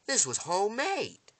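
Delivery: loud, high-pitched realisation; amazed indignation. Retold or imitated. Villain inspects the bullet's bottom, amazed indignation. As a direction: exclaim it high, playing the villain.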